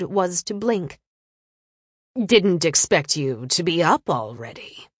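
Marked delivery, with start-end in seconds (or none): 2.2-4.9 lazy arrogant nobleman. A narrator's reading. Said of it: "he drawled".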